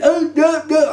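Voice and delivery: high, mock-frustrated oh no; retelling a flubbed take with a mock groan; mock dismay. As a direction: groan at botching the pour again. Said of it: "I've been going".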